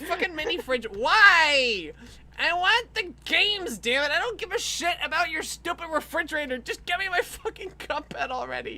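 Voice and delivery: high, loud, exasperated outburst; hammed-up angry rant. Ranting at E3 for the game, hammed-up anger. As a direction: rant in comic outrage, pitch way up.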